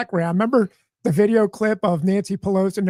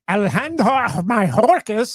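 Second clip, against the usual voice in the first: put-on Alejandro Mayorkas rolled with a slow Spanish accent; mock Spanish accent; 4 dB louder than usual. Mocking a reporter's name to friends, slow Spanish accent.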